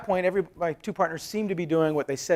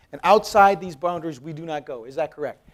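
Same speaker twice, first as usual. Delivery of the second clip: firm challenge; 3 semitones higher, 10 dB louder than usual. Retold or imitated. Retold pressing partners to agree.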